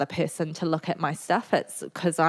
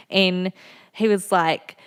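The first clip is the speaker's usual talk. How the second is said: usual speaking voice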